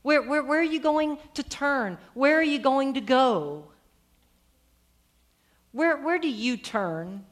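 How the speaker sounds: drawn-out, imploring call; crying out. Acted. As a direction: appeal urgently to someone turning away. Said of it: "kind of cries into the text saying".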